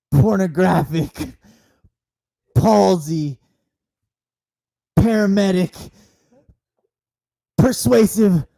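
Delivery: slow, rising proclamation; loud building praise. Acted.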